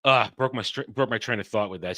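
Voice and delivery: ordinary talk, nothing marked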